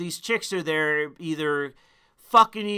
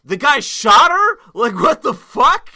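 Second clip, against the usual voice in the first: higher and louder "The guy shot her? Like, what the fuck?"; mock outraged onlooker; 6 semitones higher, 10 dB louder than usual. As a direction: play the baffled meme voice, indignant. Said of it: "it is like".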